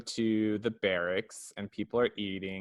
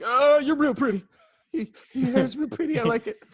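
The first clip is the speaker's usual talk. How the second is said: high, loud mock gushing; smitten admirer; 14 semitones higher, 6 dB louder than usual. Dude spots Mikasa walking by, mock smitten gushing. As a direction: gush like a hammed-up crush. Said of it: "the guy's got a real sweet spot for Mikasa".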